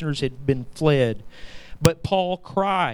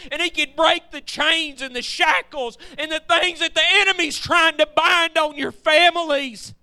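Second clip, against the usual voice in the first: much higher, shouting preacher's climax; preaching at full force; 13 semitones higher than usual. Acted. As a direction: rain it down on the church at the top of your voice.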